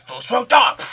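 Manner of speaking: loud, high burst of impact noise; mimicked elbow strike. Vlogger re-enacting the footballer's elbow strike, loud mimicked blow. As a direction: throw out a big strike noise.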